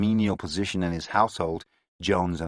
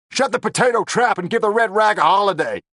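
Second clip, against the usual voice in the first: loud high gangster bark; angry gangster; 14 semitones higher, 8 dB louder than usual. A narrator's reading. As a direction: bark at her to shut up. Lavelle barks at her.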